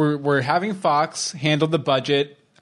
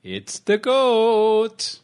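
spooky voice; 9 semitones higher, much slower than usual. Acted. Spooky goat line.

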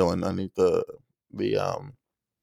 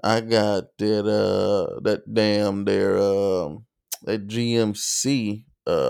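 ordinary delivery

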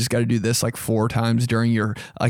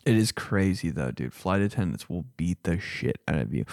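ordinary delivery

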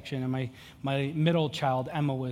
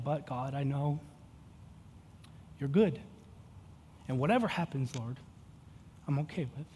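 much softer, yielding prayer; quiet surrender; 3 semitones lower, 9 dB quieter than usual. Retold or imitated. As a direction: let it go softly to God. Quiet surrender prayer after yelling.

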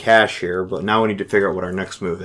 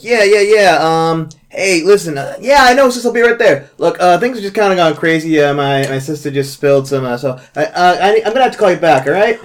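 flustered excuse; 8 semitones higher, 5 dB louder than usual. Acted. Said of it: "Be like".

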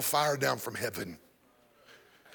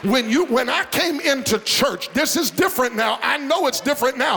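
high, driving sermon delivery building to a shout; rousing preacher in full cry; full-force preaching; 9 semitones higher, 6 dB louder than usual. Real, not acted. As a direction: preach it hard and high, stretching the climax.

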